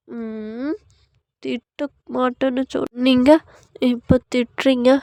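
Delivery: slow, pouting complaint; sulky wife. Acted. Wife hurt that husband scolds her, sulky. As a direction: pout over a broken promise.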